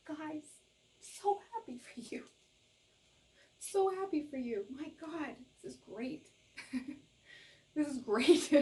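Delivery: high, delighted gushing with laughs; overjoyed. Real, not acted. Tarot reader delighted for the group, high and laughing.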